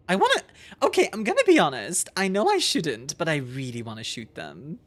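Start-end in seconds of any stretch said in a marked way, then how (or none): none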